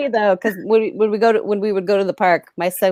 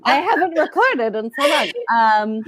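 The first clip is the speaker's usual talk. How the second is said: usual speaking voice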